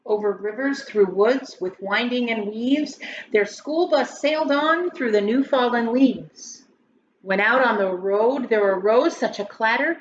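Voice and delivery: lilting rhymed storybook read-aloud; story narration. Acted. Teacher reading a Thanksgiving poem to grade schoolers, lilting. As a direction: read it like a bedtime poem for kids.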